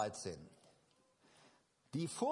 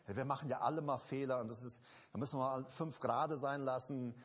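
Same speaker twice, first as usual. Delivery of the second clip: lightly mocking excuse; 6 semitones lower, faster than usual. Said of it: "fängt an zu sagen".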